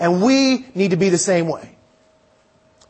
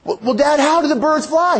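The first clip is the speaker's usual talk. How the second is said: put-on higher-pitched, eager young son; excited kid; 5 semitones higher than usual. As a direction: ask Dad with mounting excitement. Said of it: "he gets all excited".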